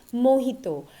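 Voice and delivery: mojito as mo-HEE-to; correct pronunciation. Shown as a demonstration. Teacher introducing the first food word, correct pronunciation. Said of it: "exact pronunciation is mojito".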